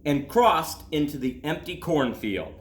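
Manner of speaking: put-on gentle storybook reading for children; calm read-aloud to kids; story narration. A narrator's reading. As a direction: read it like a bedtime picture book. Reads a picture book aloud.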